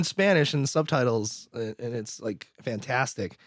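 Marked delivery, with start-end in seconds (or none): none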